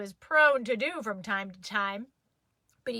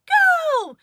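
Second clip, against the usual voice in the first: loud, high yell of go; Daddy yelling the sprint start; 11 dB louder than usual. Retold or imitated. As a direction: shout the start. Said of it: "by the time Daddy yelled".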